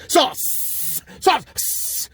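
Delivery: sauce with a long hissing S at the end; hissed final S. Shown as a demonstration. Showing the hidden S in sauce, hissed ending. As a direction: lean on the S of sauce. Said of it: "you can hear the S".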